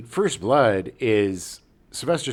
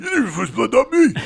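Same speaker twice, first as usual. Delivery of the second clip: high squeaky joke, then louder; silly high voice; 10 semitones higher, 5 dB louder than usual. Pretend. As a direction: pipe it up in a goofy voice.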